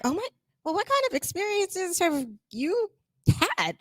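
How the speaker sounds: sniffy disbelieving question; a skeptical boss doubting the intern idea; mock skeptical. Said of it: "it's just like".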